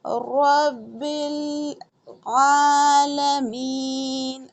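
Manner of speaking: रब्बिल with a heavy, full ra, very slow. Shown as a demonstration. Teacher demonstrating the heavy ra, slowly chanted. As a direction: stretch रब्बिल आलमीन, ra thick and drawn out. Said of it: "रब मोटा करके बा को लाम से".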